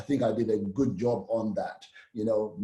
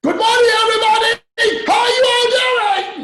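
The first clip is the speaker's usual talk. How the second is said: much higher and much louder, yelled out; hammed-up shouting hello to the room; too-loud opening that feels weird; over-the-top shouted greeting; 13 dB louder than usual. Shown as a demonstration. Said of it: "if I was starting out today, I was like".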